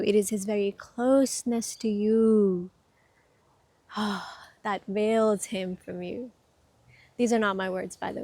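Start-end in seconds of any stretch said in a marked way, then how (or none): 0.0-6.3 slow, drawn-out line with a sigh midway; sigh, then stretch it out slowly, pausing for weight; weighty recitation. Real, not acted. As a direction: let each phrase land slowly.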